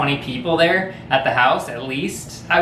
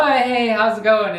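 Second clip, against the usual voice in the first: lightly voiced greeting; 7 semitones higher than usual. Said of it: "they go".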